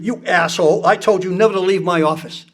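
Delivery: ordinary talk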